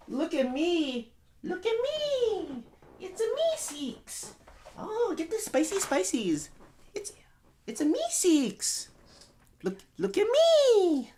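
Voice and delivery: very high, drawn-out falsetto cartoon voice; strained high squeak, stretching every phrase; squeaky cartoon character. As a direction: squeal it slowly like an attention-seeking cartoon helper. Plays squeaky cartoon Meeseeks.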